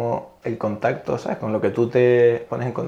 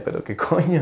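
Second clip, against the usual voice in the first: higher-pitched mock disbelief; retold astonishment; 6 semitones higher than usual. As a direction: replay your disbelief in a higher voice. Retelling own astonishment at the results. (asombro recreado, en tono más agudo)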